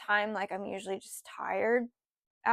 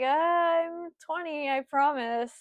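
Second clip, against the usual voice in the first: slow, pitched-up earnest promise; higher pleading insistence; 5 semitones higher, slower than usual. Retold or imitated. Insisting to the manager she is twenty, slow pleading voice.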